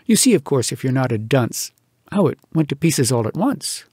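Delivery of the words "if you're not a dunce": plain reading voice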